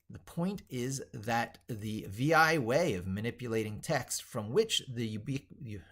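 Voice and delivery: ordinary talk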